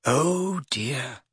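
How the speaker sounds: soft, understated dismay; stricken. A narrator's reading. Hermione watches Hagrid slam the door, stricken. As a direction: let it out quietly, dismayed. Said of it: "looking stricken".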